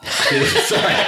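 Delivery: sorry through a burst of laughter; giggling so hard the word squeaks out; laughing apology. Real, not acted.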